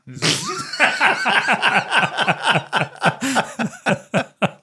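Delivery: long high giggle. Real, not acted. Joke about holding a sausage.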